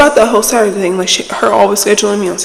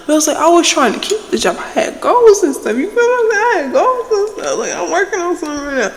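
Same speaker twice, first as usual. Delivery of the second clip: high squeaky rant; 9 semitones higher than usual. Real, not acted. Vlogger venting about losing her job, high squeaky. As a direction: pitch jumps way up, half-laughing.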